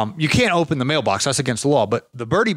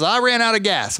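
put-on higher old man's voice with ran stressed; imitating old man; 8 semitones higher than usual. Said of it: "But he's".

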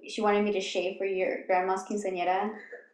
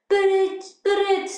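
high-pitched fake Italian-like accent; exaggerated Italian-ish accent, pitched up; mock Italian-sounding accent; 10 semitones higher, 4 dB louder than usual. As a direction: imitate an overdone, almost Italian accent. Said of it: "almost Italian or something".

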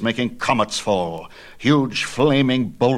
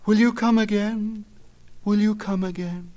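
high, light nymph voices; nymphs calling back; 12 semitones higher than usual. A narrator's reading. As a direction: sing it out like longing girls. Nymphs answer the departing hero.

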